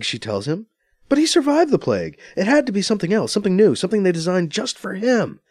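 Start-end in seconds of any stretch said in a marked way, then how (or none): 1.1-5.4 high, pressing rant. A narrator's reading. Anita ranting to B C about the killing. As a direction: insist it was murder. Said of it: "she rants".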